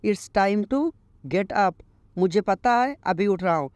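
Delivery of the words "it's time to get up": very slow, each English word laid out clearly; slow teaching read. Shown as a demonstration. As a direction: model the sentence slowly for learners. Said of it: "उठने का समय आ गया है।".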